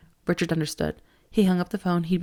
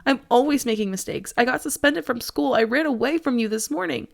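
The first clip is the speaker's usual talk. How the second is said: sobbing; 6 semitones higher, 4 dB louder than usual. A narrator's reading. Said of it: "Brandon sobbed".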